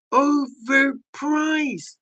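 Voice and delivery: very slow, soft and dragged out; overpriced drawn out slowly and quietly. Shown as a demonstration.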